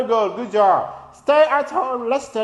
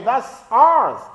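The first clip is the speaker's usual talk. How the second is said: usual speaking voice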